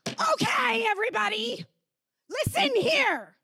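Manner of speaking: high strained mock bark at a crowd; mock shouting at everyone to listen; mock harsh command. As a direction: play the harsh voice peace is not. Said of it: "That's not peace".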